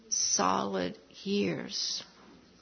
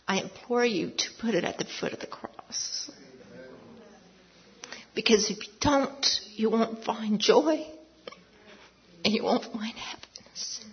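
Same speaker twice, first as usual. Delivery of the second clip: emotional plea; 4 dB quieter than usual. Real, not acted. Tearful plea to congregation.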